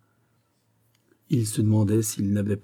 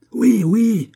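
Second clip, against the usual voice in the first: very high, loud hollering; frantic yell; 13 semitones higher, 7 dB louder than usual. A narrator's reading. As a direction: yell it like a raving madman. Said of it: "hurla l'énergumène en mauvais anglais". (hurlement aigu de forcené)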